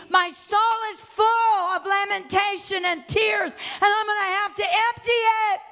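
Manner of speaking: rising, high-pitched sermon cry; impassioned preaching. Real, not acted. Preacher builds to outcry.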